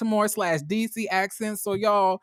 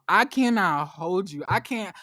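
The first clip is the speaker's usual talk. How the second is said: normal talking voice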